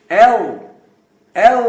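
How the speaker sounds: "ایل" with sharp onset and heavy dark L; thick letter L. Shown as a demonstration. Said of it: "اس ایل کو موٹا پڑھیں گے".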